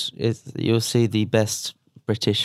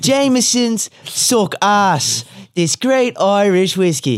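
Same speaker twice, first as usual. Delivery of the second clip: high, slow, loud Irish whiskey pitch; hammed-up Irish accent selling whiskey at a press conference; mock fighter plugging his whiskey in a high Irish voice; 10 semitones higher, 5 dB louder, slower than usual. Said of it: "in the press conference like".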